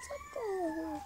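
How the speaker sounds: high, soft little squeak; a tiny mouse cries help; squeaky cry for help. Acted.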